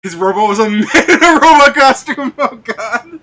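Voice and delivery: loud, high-pitched outburst through laughter; laughing exclamation. Real, not acted. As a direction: crack up while shouting it out. Cracking up over absurd anime detail.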